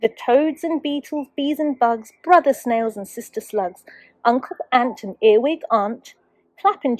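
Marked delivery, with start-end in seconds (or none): none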